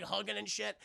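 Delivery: ordinary delivery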